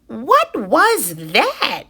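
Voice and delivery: put-on high, slow startled baby bird; a baby bird spooked by a stranger; startled cry. A narrator's reading. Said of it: "cried Flap".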